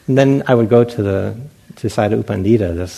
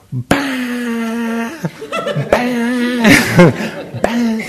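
much higher blaring beetle call, laughing; beetle alarm imitation; 14 semitones higher, 5 dB louder, much slower than usual. Imitating a Burmese beetle that sounds like a fire alarm. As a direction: blast it like a buzzing alarm bug.

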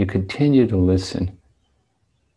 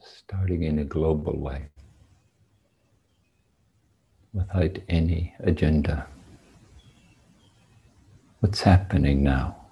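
guided meditation; 2 semitones lower, 7 dB quieter than usual. Real, not acted. Guides meditation after the bell.

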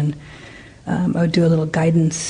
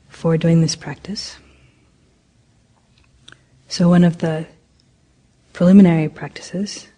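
guided meditation. Acted. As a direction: ease the group into stillness, unhurried. Leads a metta meditation.

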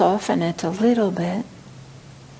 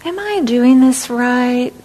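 louder, slow, insistent self-doubt; a pressured inner critic asking; anxious self-critic; 4 semitones higher, 7 dB louder than usual. Retold or imitated. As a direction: demand it of yourself anxiously. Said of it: "we ask".

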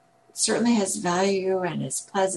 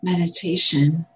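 calm, spacious meditation guidance; guided meditation; 8 dB louder than usual. Acted. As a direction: guide gently with long pauses. Leads a meditation.

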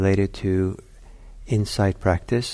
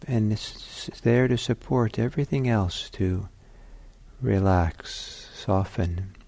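guided meditation. Acted. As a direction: talk them gently into stillness.

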